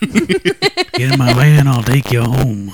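very slow creepy coaxing; creepy stranger voice. Pretend. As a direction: draw it out like a lure.

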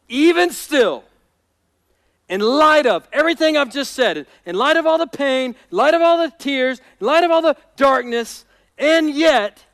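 loud, rhythmic sermon build; impassioned preaching. Real, not acted. Preacher declaring David's trust amid pain and tears, emphatic.

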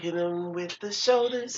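drawn-out silly chant; slow goofy voice. Pretend. Silly slowed catchphrase.

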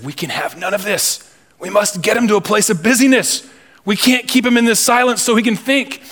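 high-pitched urgent plotting, acted out; urgent scheming devils. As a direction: play a demon urging his partner to act. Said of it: "they created a scenario with urgency".

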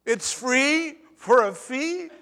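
scoffing at the ad's free-for-a-fee pitch; incredulous. Real, not acted. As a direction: drag it out in mock amazement. Mocking the ad's logic.

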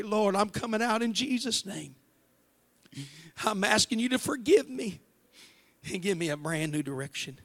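calling out a prayer of repentance; fervent prayer. Acted. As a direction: pray it out loud with conviction. Leads congregation in prayer.

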